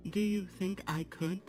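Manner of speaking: usual speaking voice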